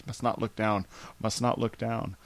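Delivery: mock scared muttering. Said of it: "afraid of heights".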